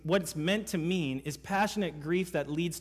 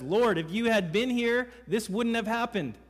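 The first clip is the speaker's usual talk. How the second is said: higher, louder frustrated plea; frustrated Martha; 7 semitones higher, 4 dB louder than usual. Retold or imitated. Martha to Jesus after her brother died, frustrated raised plea. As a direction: blame him for not coming in time.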